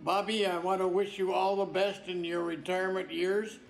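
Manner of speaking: ordinary delivery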